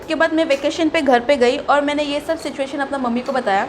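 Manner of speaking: ordinary delivery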